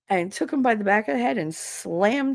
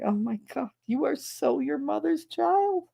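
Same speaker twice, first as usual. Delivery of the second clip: retold astonished admiration; amazed mom; 9 semitones higher, 3 dB quieter than usual. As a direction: draw it out high in amused disbelief. Mother amazed at son's revenge.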